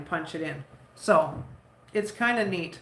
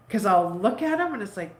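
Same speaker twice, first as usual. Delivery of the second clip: normal talking voice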